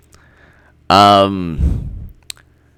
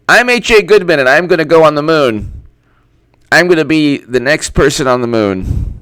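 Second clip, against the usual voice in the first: raised, hammed-up proclamation; mock candidate speech; 6 semitones higher than usual. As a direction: announce it like a grand campaign boast. Parodies the speech with own name.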